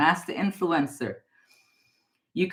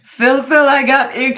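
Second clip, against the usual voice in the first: much louder, higher, thrilled call-out; excited; 7 semitones higher, 11 dB louder than usual. Real, not acted. Psychic reading for viewer Phil, thrilled loud call. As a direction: burst out like the cards just hit home.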